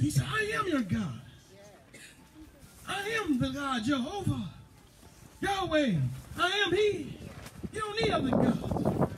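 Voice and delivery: high, fervent preacher's cry; preaching. Acted. Preacher rousing the congregation, fervent preaching. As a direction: preach it out full force to the church.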